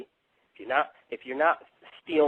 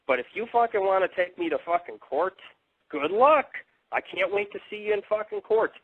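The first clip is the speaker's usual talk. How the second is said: angry taunt; 6 semitones higher, 3 dB louder than usual. Real, not acted. Taunting the man suing him.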